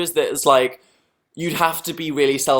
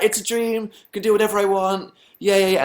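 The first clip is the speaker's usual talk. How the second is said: high-pitched mock triumph; gleeful dream self; 7 semitones higher than usual. Dreamer defying maths teacher in a retold dream, mock gleeful. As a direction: crow it in a higher voice, acting out a dream. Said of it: "be like".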